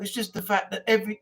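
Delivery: ordinary talk, nothing marked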